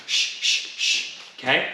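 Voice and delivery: quick unvoiced shh breaths; sharp breaths with punches. Shown as a demonstration. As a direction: hiss out short breaths like punching. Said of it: "Always breathing".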